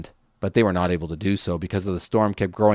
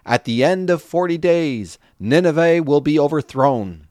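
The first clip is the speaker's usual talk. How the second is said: raised, high, ringing announcement; public proclamation; 6 semitones higher than usual. Acted. Jonah announcing doom to Nineveh, raised and ringing. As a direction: call it out to the whole city.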